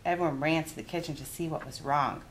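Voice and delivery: ordinary delivery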